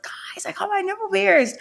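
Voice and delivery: high, hushed squeal of news; breathless high-pitched mock teen excitement; excited teenage announcement. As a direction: re-enact telling friends thrilling news in a high, thin voice. Retelling her excited teenage announcement.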